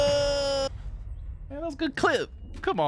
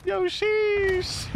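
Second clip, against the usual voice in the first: excited sheesh. Real, not acted. Reacting to a kill.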